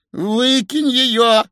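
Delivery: high, drawn-out demand; insistent. A narrator's reading. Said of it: "настаивала старуха". (настойчивое требование старухи)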